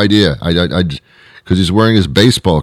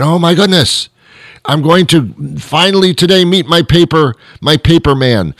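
high, thrilled inner voice; hammed-up eager excitement; excited anticipation; 8 semitones higher than usual. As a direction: act out giddy anticipation, pitched well up. Acts out excited thought on hearing car.

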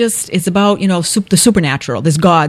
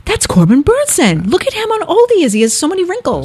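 high-pitched amazed outburst, retelling the moment; excited, much higher than usual; 11 semitones higher than usual. Retold or imitated. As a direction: relive a shocked recognition, pitch leaping up. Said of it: "You're very excited about this".

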